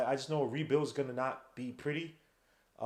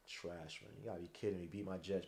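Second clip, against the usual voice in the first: disgusted mutter; 9 semitones lower, 14 dB quieter than usual. Real, not acted. Grumbling over Eagles beating Jets.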